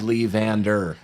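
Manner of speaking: slow, deliberate "Lee Van Der"; over-enunciated name. Retold or imitated. Coaches naming the player they need, over-enunciated. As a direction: spell out "Lee Van Der" word by word, drawn out. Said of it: "we've definitely gotta enunciate it as they do".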